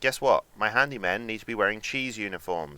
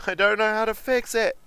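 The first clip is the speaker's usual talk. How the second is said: much higher, louder, frantic panic; high frantic wail; 12 semitones higher, 5 dB louder than usual. Acted. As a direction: wail it out in mock despair.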